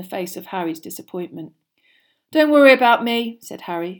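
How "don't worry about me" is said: raised, louder would-be casual reply; trying to sound offhand; forced offhand. A narrator's reading. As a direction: brush off concern a little too loudly. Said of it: "what he hoped was an offhand voice".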